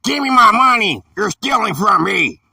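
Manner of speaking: high, indignant mock yell; a little kid shouting for his money; kid demanding money. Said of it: "So, like".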